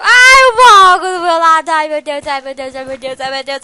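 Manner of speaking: ordinary talk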